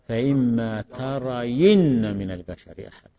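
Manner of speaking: slow down and lean on "ترين" as ta-ra-yinna; kasra on the ya. Shown as a demonstration. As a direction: stretch "ترين", voicing yi before a doubled n. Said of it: "نبقي الياء ونحركها بالكسر". (إبراز كسر الياء وتشديد النون في «ترين» ببطء)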